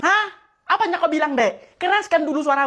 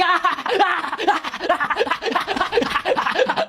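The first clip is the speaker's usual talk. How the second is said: gleeful prankster laugh; triumphant cackle after the prank; 3 semitones higher than usual. Acted.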